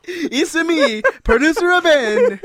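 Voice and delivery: high, loud mock Italian with a laugh in it; drawn-out, hammed-up Italian accent; Mario-style Italian accent. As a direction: announce yourself as a cartoon Italian plumber. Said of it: "record my fucking voicemail outgoing message like that".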